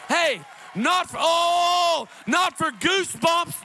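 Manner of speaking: loud, slow, high preaching cry; full-force preaching; preaching shout. Real, not acted. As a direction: build the congregation up with a shout. Preaching climax.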